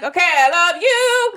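high, drawn-out singsong declaration; exaggerated sincerity. Wife told by husband to mean it, hammed-up singsong. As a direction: overdo the love in a slow lilt. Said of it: "Say it like you mean it".

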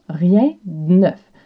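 rien de neuf avec d et n ensemble, le e muet; slow pronunciation demo. Shown as a demonstration. (de dit d, collé au n)

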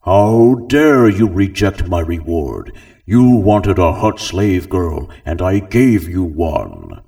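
slow, loud booming Hutt rage; slow, loud outraged crime lord; furious Jabba. Acted. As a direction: thunder it down at the guest. Jabba rages.

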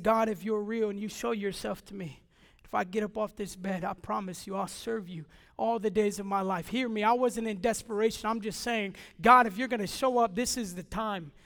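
hushed dying prayer; quiet prayer. Retold or imitated. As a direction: pray barely above breath.

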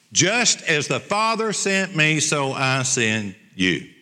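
slow, dropping low on send you; solemn, slow. Acted. Preacher giving Jesus' commission to the church, solemn. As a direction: lay the words down with weight.

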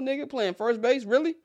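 Really? rising way up, can't believe it; incredulous. Real, not acted. Scoffing at fans who trust Frank Schwindel, incredulous. Doubting fans' faith in Schwindel.

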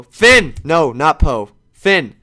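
blurting the name out loud as it comes back; a loud remembered-it shout; shouting the right name in a burst; sudden loud correction. Real, not acted.

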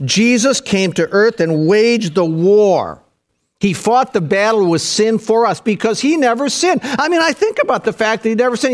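high, fired-up sermon delivery; impassioned preacher in full flow; full-force preaching. Acted. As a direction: preach it urgently, piling phrase on phrase.

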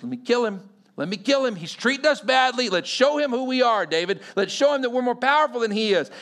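hotheaded urging. Acted. Abishai begs David to let him strike back, hotheaded urging. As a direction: push the plea harder as it builds, like an eager henchman.

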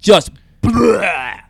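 a quick mock gagging "bleh"; imitated retching noise. Telling a friend about the puppy vomiting, mock retching. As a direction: spit out a gross vomit sound. Describing the dog throwing up.

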